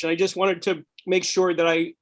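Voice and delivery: normal talking voice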